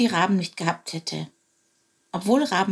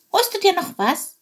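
chirpy little bird voice; high sparrow voice; 11 semitones higher, 3 dB louder than usual. Acted. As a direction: speak like a small eager sparrow. Chilp speaks to raven.

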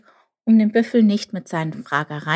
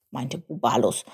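ordinary delivery

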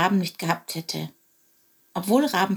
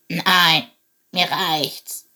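put-on old raven with a creaky voice; 4 dB louder, much slower than usual. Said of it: "mit knarrender Stimme".

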